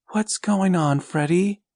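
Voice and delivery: a hint of a worried mom woken at night; mother's voice. A narrator's reading.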